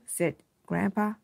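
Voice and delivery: usual speaking voice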